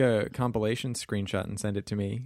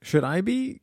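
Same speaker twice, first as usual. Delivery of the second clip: mock alarmed; 12 semitones higher, 5 dB louder than usual. Mock alarm at cancel worry.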